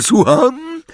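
high mocking demand; sharp stern teacher. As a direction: snap back a cold question. Snape demanding a repeat.

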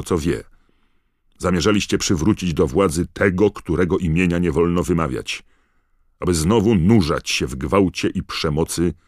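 forceful courtroom roar. A narrator's reading. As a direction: thunder out the charges like a merciless prosecutor. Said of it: "ryknął pan Crouch".

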